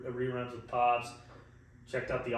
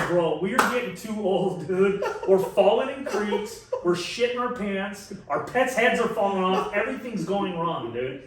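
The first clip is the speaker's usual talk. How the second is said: loud, raised comic complaint; exasperated rant; 4 semitones higher, 5 dB louder than usual. Retold or imitated. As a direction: rattle off the woes, half laughing. Acted exasperated rant to his buddy.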